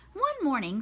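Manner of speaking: ordinary delivery, nothing marked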